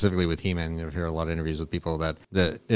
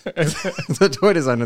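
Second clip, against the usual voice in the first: laughing; 9 semitones higher, 5 dB louder, much slower than usual. Real, not acted. Laughing while correcting friend.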